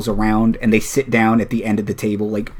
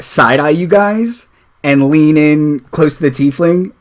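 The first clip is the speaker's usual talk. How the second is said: ordinary talk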